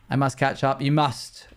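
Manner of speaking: usual speaking voice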